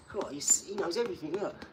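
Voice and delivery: high, teasing amazement; mock awe. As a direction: pretend to be impressed. Mocking troll's knowledge.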